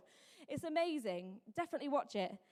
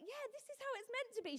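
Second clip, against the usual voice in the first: an eager, affirming yeah, pitched up; delighted approval; 11 semitones higher, 5 dB quieter than usual. Retold or imitated. Mum seeing her child loved, delighted approval.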